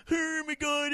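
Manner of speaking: ordinary talk, nothing marked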